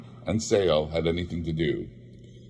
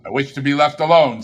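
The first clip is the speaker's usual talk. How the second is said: put-on louder, brisk old miser's refusal; curt Scrooge; 5 semitones higher, 7 dB louder, faster than usual. Old miser brushing off the charity gentlemen, curtly. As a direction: snap it out like a stingy old man.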